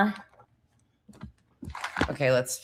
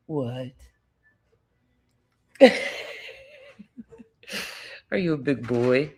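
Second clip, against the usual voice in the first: ordinary talk, nothing marked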